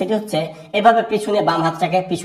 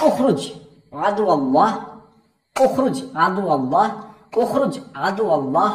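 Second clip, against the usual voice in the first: slow, spaced-out command with pauses between words; slow clear demo of the exorcism words; slow teaching version; slower than usual. Shown as a demonstration. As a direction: spell it out slowly for the learners. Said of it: "আমি একটু ধীরে ধীরে বলছি".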